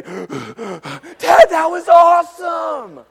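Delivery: put-on breathless kid shouting with joy; breathless thrill. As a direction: gasp it out, still buzzing from the ride. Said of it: "He's breathing heavy".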